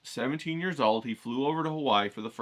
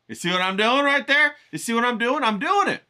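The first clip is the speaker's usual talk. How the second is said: much higher and louder, delighted with himself; pleased showman's boast; excited gloating; 10 semitones higher, 7 dB louder than usual. Real, not acted. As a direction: crow over your own trick to the viewers. Pointing out his own dramatic pause.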